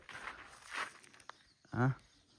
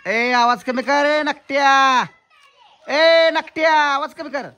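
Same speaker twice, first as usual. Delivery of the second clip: high, drawn-out yell; scolding shout; 9 semitones higher, 10 dB louder, slower than usual. Real, not acted. Yelling to turn volume down.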